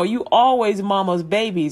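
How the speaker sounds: usual speaking voice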